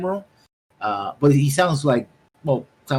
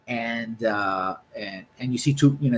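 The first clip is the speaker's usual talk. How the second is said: ordinary talk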